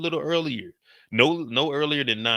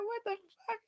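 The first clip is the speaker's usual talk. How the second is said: much higher, thin shocked squeak; 12 dB quieter than usual. Retold or imitated. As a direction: squeak it out in disbelief. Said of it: "I said".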